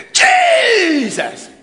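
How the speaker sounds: long high shout of the name; retold cry. Believer calling on Jesus at impact, desperate shout. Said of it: "he shouted".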